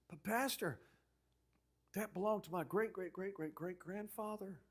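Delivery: soft imitation of a reluctant churchgoer; protesting parishioner. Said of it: "they'd always say things like".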